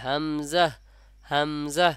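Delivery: হামজা said as "hamzah", with a buzzing z; correct z sound. Shown as a demonstration. Teacher answering how it must be said, হামজা with a z. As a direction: linger on m, then a clear z in হামজা. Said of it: "ওখান থেকে উচ্চারণ হবে".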